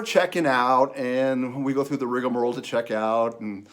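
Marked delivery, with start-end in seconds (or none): none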